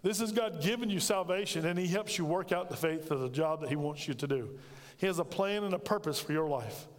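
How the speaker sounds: normal talking voice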